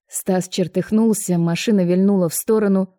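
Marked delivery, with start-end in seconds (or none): none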